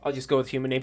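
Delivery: usual speaking voice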